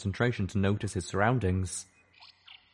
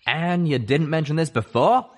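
incredulous stallion; 8 semitones higher, 6 dB louder than usual. Acted. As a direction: call her out. Calamity questions.

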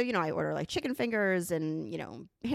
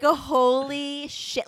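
slow, drawn-out astonishment, raised and loud; stunned disbelief; 6 semitones higher, 6 dB louder, much slower than usual. Retold or imitated. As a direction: stretch both words in retold shock. Stunned at discovering the prank.

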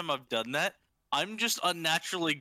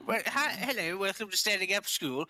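put-on Indian accent; 5 semitones higher than usual. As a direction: play a cheeky Indian accent teacher. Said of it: "I hate that you can do that well".

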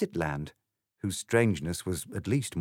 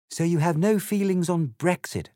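put-on a slightly higher, prim girl's voice; polite Alice, lightly voiced; 10 semitones higher, 5 dB louder than usual. Alice politely answers the egg, lightly voiced girl. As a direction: explain it courteously, a proper little lady.

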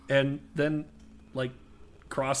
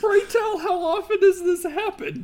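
laughing; 8 dB louder than usual. Real, not acted. Laughing mock formal question.

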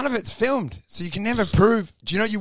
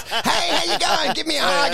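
higher, chirpy fake-friendly greeting; mock jolly greeting; 7 semitones higher than usual. Mocking the abuser playing a jolly Santa at the party. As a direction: play the beaming host who hides his temper. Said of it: "like Santa Claus".

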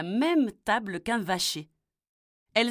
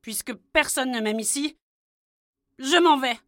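plus fort, buté, il claque la porte; départ fâché; 5 semitones higher, 6 dB louder than usual. Acted. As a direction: annonce son départ avec dépit. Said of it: "et dit". (fils blessé qui part en colère)

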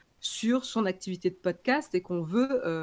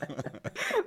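high-pitched laugh; 5 dB quieter than usual. Real, not acted. Laughing at co-host's joke.

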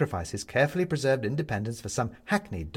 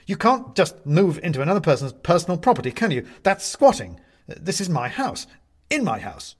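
raised, louder indignant protest; 7 semitones higher, 7 dB louder than usual. A narrator's reading. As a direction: stand up for your property, rising in anger. Benedict protests squatters.

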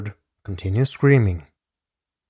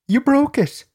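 much higher victim's voice; acted distressed cry; 3 dB louder than usual. Narrator voices victim after a blow, acted high cry.

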